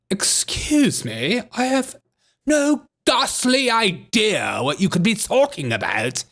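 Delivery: lightly voiced mock indignation; mock offended official. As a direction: play her as haughty and affronted. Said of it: "how triggered she gets".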